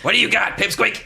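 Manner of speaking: high, loud cocky taunt; taunting boar. Acted. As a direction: sneer at the little caster. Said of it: "looks up at you and says".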